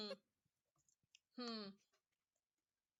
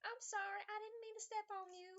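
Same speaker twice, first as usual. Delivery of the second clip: apologetic calling out; 10 semitones higher than usual. Acted. Monk calling to a fleeing rat, high apologetic call. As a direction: call an apology after it, pitched way up. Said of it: "She's just gonna call out".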